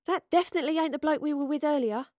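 disbelieving objection. A narrator's reading. Astrid contradicts the soldiers.

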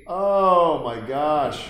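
slow shocked oh my gosh; recoiling at the screen, long and loud; stunned gasp. Real, not acted. Watching gross movie scene.

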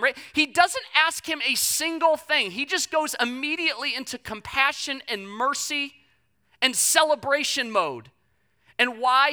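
loud, driving sermon delivery; the preacher drives it home with rising force; preaching at full force. Acted. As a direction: hammer the point home to the congregation. Preaching the father's mercy.

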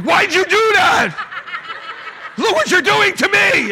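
high, loud hammed-up outrage; mad shouting. Dad getting mad at son for a seizure, mock outrage. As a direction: wail the accusation in mock fury. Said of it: "I just get mad".

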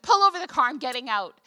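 loud, high retold outburst easing at the end; angry demand. Wife threatening to leave husband's car, loud angry ultimatum. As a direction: fire off a re-enacted angry ultimatum. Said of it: "I was so mad at him".

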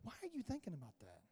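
low, very soft questioning; a quiet inner nudge, lightly voiced; Holy Spirit. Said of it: "he'll bump up to me and he'll go".

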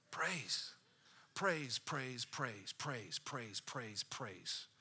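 fading whispered chant; whispered praise. Acted. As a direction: let each praise die away. Repeated word fading away.

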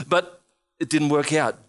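ordinary talk, nothing marked

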